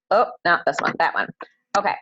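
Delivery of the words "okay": usual speaking voice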